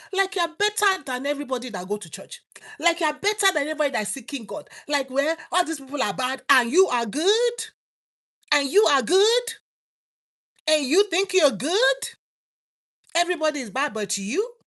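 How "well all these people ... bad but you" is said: mock self-righteous. Mocking self-righteous listener.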